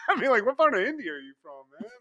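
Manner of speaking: fast, laughing question; real laughter through the words. Real, not acted. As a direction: rattle it off while laughing. Laughing while teasing.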